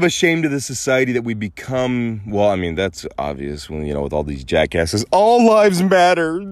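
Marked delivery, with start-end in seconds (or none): 5.1-6.4 mocking slogan.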